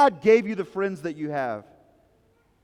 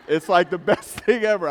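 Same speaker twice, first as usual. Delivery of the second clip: laughing; 5 dB louder than usual. Real, not acted. Laughing at the memory.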